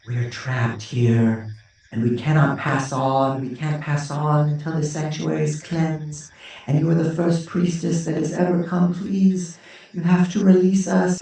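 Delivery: a trapped spirit begging for release; ghostly priestess begging; pleading ghost priestess. Acted.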